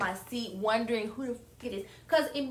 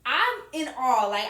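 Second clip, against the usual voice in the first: usual speaking voice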